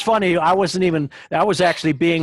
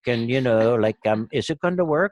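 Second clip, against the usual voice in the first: lightly voiced Irish leprechaun accent; a hint of a pleading Irish leprechaun. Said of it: "why you chose the leprechaun voice for that".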